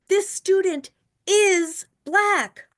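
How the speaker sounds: slow, high and loud reveal; dramatic reveal. Acted. Teacher telling her class the twist, slow loud high reveal. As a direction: land the twist slowly.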